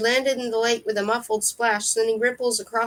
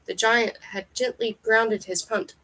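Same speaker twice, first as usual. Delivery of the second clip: ordinary delivery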